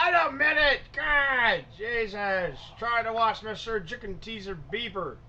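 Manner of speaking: high, exasperated holler that eases in volume; irritated shout back. Acted. Interrupted clerk calls back while watching TV, irritated shout. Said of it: "You just hear the guy laughing".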